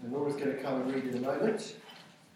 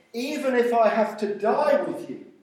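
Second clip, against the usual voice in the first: loud, high-pitched pledge; emphatic insistence; 7 semitones higher, 8 dB louder than usual. Acted. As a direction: vow it forcefully on a raised voice. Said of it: "all the others said the same".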